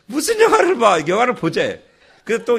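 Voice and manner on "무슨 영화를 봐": high, loud disbelieving question; incredulous. Retold or imitated. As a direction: shoot up in disbelief at the idea. Dad reacting to son's idea.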